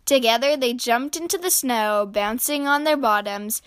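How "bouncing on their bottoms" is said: ordinary delivery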